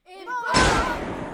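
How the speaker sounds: bang crié très aigu et fort; cri du coup de feu. Acted. L'élève crie le coup de feu. (Et bang crié très aigu)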